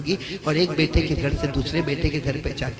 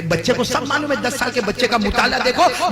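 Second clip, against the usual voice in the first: ordinary talk, nothing marked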